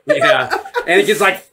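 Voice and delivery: ordinary delivery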